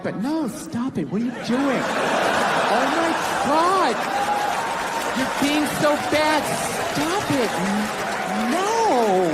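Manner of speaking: coy, giggly mock protest, in a different voice; lightly voiced flirty woman; playful no. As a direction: tease while saying stop it. Woman teasing, saying no playfully.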